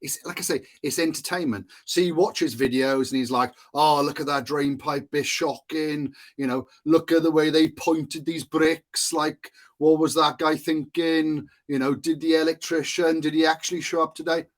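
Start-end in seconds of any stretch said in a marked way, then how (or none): 3.7-14.5 put-on slightly raised, lilting Welsh accent. Imitating a Welsh snagger complaining about shoddy building work, Welsh accent. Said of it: "Sorry for my poor Welsh accent".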